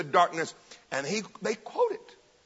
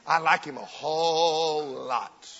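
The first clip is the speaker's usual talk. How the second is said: "whole" held long, the line slow; emphatic WHOLE; 2 semitones lower, 3 dB louder, slower than usual. Real, not acted. Preacher professing his liking for Jesus, stretching "whole".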